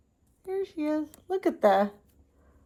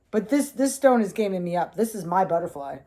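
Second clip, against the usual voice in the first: ordinary delivery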